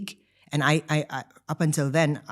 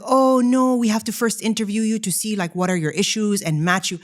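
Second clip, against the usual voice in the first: receptionist refusing; 6 semitones higher, 5 dB louder than usual. Retold or imitated. Receptionist refusing her request.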